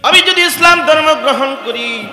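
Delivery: high, loud, chanted sermon cry; impassioned preaching. A narrator's reading. Preacher tells the crowd of a convert crying to his Lord, high loud chant. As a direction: preach it out loud and high, drawing out phrase ends.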